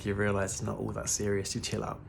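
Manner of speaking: ordinary talk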